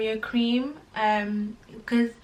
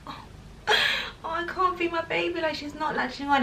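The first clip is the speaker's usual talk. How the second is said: mock despairing wail; 8 semitones higher than usual. Mom despairing over baby latching.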